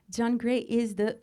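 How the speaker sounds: usual speaking voice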